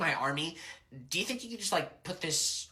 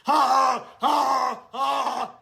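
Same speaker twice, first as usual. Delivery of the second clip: mocking ha ha; 7 semitones higher, 6 dB louder, much slower than usual. Mocking the opponent.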